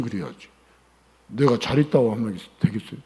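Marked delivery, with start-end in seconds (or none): none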